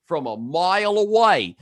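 mock L.A. drawl on the vowels; exaggerated L.A. accent. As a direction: drag the vowels out like an L.A. dude. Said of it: "It may not be a good L A accent".

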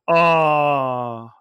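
long, loud falling "ah" of a letdown crowd; disappointed crowd groan. Retold or imitated. Retelling fans' reaction to an interception, mock crowd groan. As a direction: imitate a whole crowd groaning. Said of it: "the whole crowd went like".

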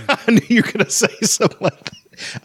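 talking through real laughter; high giggly reply to a joke; laughing at the co-host's Atari joke. Real, not acted.

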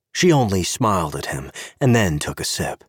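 ordinary delivery

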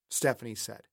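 ordinary delivery

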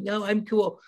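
a hint of a cool kid posing; high schooler bragging. Player mimics buddies at high school parties, lightly mocking.

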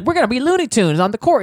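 rally the team playfully; hyped team player. Retold or imitated. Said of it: "he's like".

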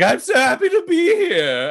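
high, gushing squeal of delight; flamboyant aristocrat. Acted. Beloved heir greeting the gathered guests, high gushing voice. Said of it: "he's like".

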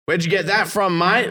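a gruff Aussie worker asking; Australian bloke challenging; Australian accent, challenging. Retold or imitated. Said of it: "And he goes".

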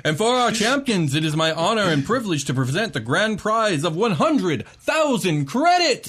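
high, loud, slowing on the prize; booming prize announcer. Acted. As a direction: savor the big number like a game-show host. Announcer presents the grand prize.

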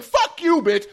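high-pitched aggressive outburst; mock aggressive. Shown as a demonstration. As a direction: hurl it like a real insult, pitch way up. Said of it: "leading to a different type of feeling".

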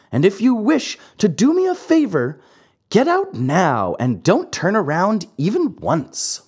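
a lightly voiced naughty puppet ordering him out; cheeky marionette. Pinocchio's held voice.

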